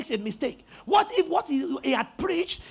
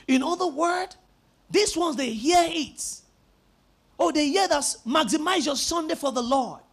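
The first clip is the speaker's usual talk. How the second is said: usual speaking voice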